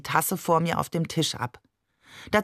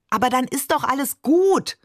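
a high, louder outburst; hot-tempered call; 5 semitones higher, 3 dB louder than usual. A narrator's reading. Leila at breakfast, flaring up at Barbe. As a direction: snap back just as fired up. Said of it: "rief ich fast genauso aufbrausend".

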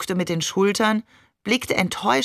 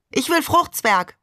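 put-on child voice; 7 semitones higher than usual. A narrator's reading. Little brother demands dessert.

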